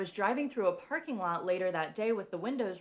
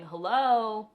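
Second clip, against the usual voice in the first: loud, higher man's voice repeating; man's voice echoing; 5 semitones higher, 10 dB louder, much slower than usual. Retold or imitated. A spirit guide answering her plea, loud and teasing. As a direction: call it out, louder each time. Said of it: "a man's voice going".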